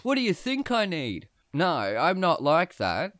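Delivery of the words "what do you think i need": higher, mock-swaggering show-off; cocky braggart. Imagined cocky answer to the cashier, mock swagger. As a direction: ham up a lad bragging about his size. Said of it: "seem like a wanker".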